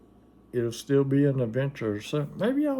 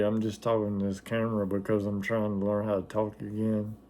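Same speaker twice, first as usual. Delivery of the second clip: ordinary delivery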